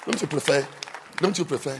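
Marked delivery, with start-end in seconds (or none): none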